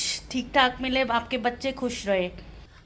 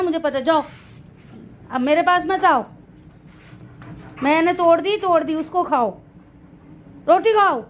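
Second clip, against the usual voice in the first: high, loud pet talk; pleading with the dog; 6 semitones higher, 4 dB louder than usual. Real, not acted. Mother arguing with her sulking dog over the roti in high, loud pet talk. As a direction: argue fondly with a sulking pet.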